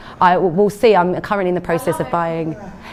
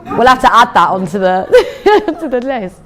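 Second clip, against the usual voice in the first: laughing; 4 semitones higher, 7 dB louder, slower than usual. Real, not acted. Amused by sweet and sour.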